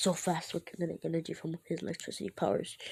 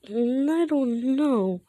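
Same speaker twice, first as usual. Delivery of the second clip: high, loud, drawn-out character voice; put-on cluelessness; 7 semitones higher, 6 dB louder, much slower than usual. Grape unsure what his quirk can do, put-on cluelessness. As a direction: whine it out slowly in a silly voice.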